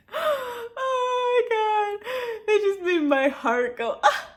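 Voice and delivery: high, slow, squeaky fangirl gush; overwhelmed gushing. Real, not acted. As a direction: melt audibly, high and drawn out. Overwhelmed by a sweet scene.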